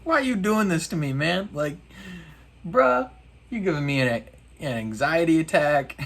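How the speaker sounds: a hint of a weary dad pleading; son's antics drive dad to complain, lightly voiced; disappointed dad. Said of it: "He's like".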